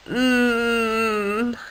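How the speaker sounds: soft nasal mm from a cold; stuffed-up mm. Acted. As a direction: answer through a blocked nose. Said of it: "ears were all plugged up from his cold".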